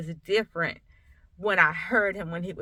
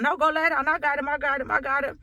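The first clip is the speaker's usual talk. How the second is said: husband's reassurance, retold; 6 semitones higher than usual. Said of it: "so he's like".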